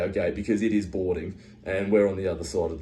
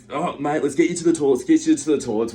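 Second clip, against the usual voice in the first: higher, louder, hurried urging; retold urgency; 6 semitones higher, 4 dB louder, faster than usual. Friends rush vomiting mate to toilets, retold urgency. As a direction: hustle a sick mate along.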